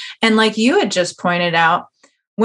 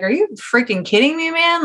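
incredulous retort; 8 semitones higher than usual. Retold or imitated. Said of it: "And I was like".

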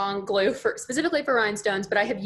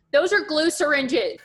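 slow, higher, louder exasperation; exasperated explaining; 7 semitones higher, 4 dB louder, slower than usual. Retold or imitated. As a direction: spell it out slowly to clueless parents. Explaining to worried parents.

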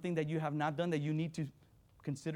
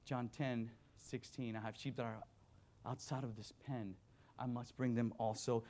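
hushed scripture reading; 4 semitones lower, 7 dB quieter than usual. Acted. Pastor recalling the verse that night, hushed reading. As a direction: read it gently as a quiet revelation.

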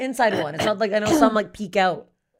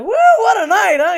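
much higher, loud party whoop; whooping excitement; 12 semitones higher, 10 dB louder than usual. Retold or imitated. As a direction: cheer it like the morning after a wild night. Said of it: "I'm like".

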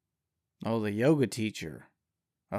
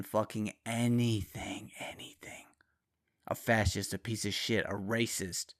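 hushed, breathy aside; whispering; 5 dB quieter than usual. Real, not acted. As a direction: whisper it like a secret. Conspiratorial aside about Republicans.

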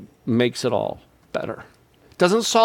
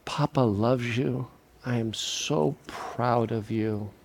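slow, much softer words close to the ear; tender whisper; 3 semitones lower, 8 dB quieter, slower than usual. Retold or imitated. Grandpa to grandkid in his ear, slow and much softer. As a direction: murmur it gently into a grandchild's ear. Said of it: "And I say in their ear".

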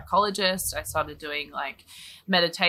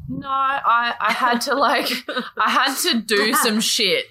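higher, louder push-back with a laugh in it; indignant protest; 7 semitones higher, 4 dB louder than usual. Acted. Acted protest to envious people.

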